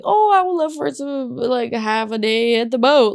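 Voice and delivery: high, loud self-imitation; mock planner's wish. Woman imitating her own pre-planning, high mock gushing. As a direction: put on a high, gushing wishful voice.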